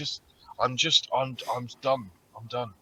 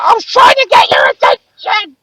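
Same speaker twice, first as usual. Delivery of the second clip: much higher, much louder indignant squeal; shrill mock protest; 17 dB louder than usual. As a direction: squeal it like a caught thief protesting. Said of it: "She was like".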